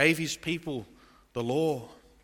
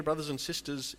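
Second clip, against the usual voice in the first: ordinary talk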